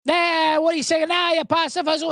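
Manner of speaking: high hammed-up Italian-American voice; jeering Italian-American caricature; mock Italian accent. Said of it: "every Italian impression starts off with the voice".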